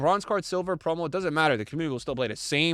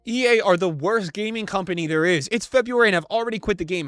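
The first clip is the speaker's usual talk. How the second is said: loud, higher-pitched comment read; mock angry rant; 4 semitones higher, 5 dB louder than usual. Streamer reads a furious review of the game, mock angry. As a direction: rant it like a fed-up gamer.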